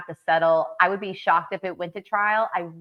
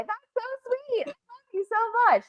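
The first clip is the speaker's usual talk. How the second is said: near tears; 13 semitones higher than usual. Real, not acted. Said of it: "I'm gonna cry".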